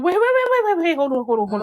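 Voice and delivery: high, rushed panicky pleading; mock panic attack at closing swords; panicked. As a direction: beg them to stop, half-joking panic. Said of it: "I would be like".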